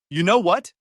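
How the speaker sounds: loud, high angry yell. Acted. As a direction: yell it in his face. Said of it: "she yelled".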